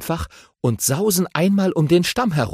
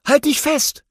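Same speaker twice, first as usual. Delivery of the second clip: higher, eager little hero voice; friendly young aardvark, voiced higher; Tafiti voice. A narrator's reading. Tafiti politely asks the grumpy badger for help, eager and high. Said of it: "ruft er".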